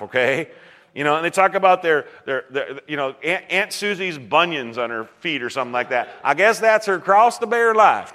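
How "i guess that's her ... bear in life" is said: hammed-up Southern twang, raised in pitch; imitated Southern accent. Preacher mimicking talk of Aunt Susie's bunions, in Southern accent. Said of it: "Excuse my poor Southern accent".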